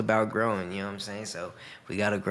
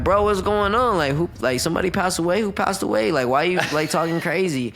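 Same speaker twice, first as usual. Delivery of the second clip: higher, louder, worried cousin calling out; alarmed cousin; 7 semitones higher, 4 dB louder than usual. Retold or imitated. Cousin in the other room hears yelling, alarmed calling out. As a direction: play the cousin rushing in, scared someone died. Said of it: "my cousin was in the other room".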